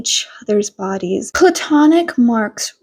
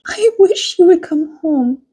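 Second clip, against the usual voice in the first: higher, longing wife's voice; wife pleading with husband to return; lightly voiced longing mother; wistful wife; 5 semitones higher than usual. A narrator's reading.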